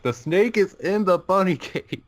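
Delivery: high-pitched, drawn-out retold cry of alarm; sister's alarmed announcement. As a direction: imitate a sister breaking startling news, high and slow. Acted quote of sister announcing snake.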